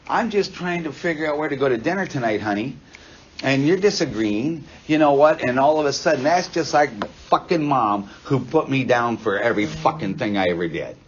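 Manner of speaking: loud, heated rant at a partner; acted angry outburst. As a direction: blow your top over a small disagreement. Acted fight over dinner plans.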